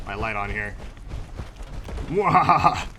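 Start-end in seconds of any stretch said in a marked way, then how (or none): 2.1-2.9 mad scientist laugh. Pretend. Evil laugh while turning on light.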